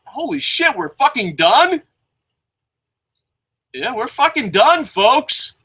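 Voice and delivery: thrilled it's already over; real delighted surprise; excited disbelief. Real, not acted. Amazed the show is over.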